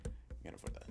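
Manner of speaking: breathy whisper to himself. Real, not acted. Streamer whispers it to himself while chat listens, hushed. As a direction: whisper the line under your breath.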